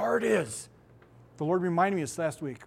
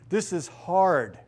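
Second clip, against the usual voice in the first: ordinary talk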